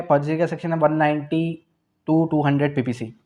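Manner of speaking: ordinary delivery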